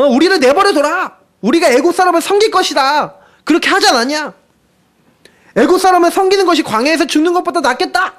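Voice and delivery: high-pitched, indignant barrage of complaint; pouring out complaints. Acted. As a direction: act out a people hurling reproaches at their leader. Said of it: "퍼붓습니다".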